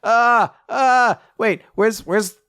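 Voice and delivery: high, loud panicked self-questioning; acted frantic fluster. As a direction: blurt it out, catching yourself in alarm. Acts out flustered older brother.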